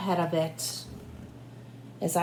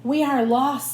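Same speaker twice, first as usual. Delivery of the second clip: loud despair; 5 semitones higher, 5 dB louder than usual. Acted. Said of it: "said the people".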